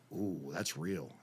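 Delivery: low, slow, softened ooh; drawn-out and subdued; awed reaction. Retold or imitated. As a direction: imitate students taking it in, hushed. Students' awed reaction, retold.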